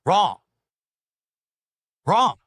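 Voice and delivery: loud, blunt verdict; emphatic wrong. Real, not acted. Bluntly rejecting a viewer's lore claim, loud. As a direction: bark it like a buzzer.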